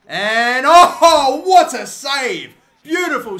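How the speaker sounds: loud rising cry, drawn out at the end; amazed shout. Real, not acted. Commentator hailing the keeper's save, amazed shout. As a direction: marvel at it at full voice.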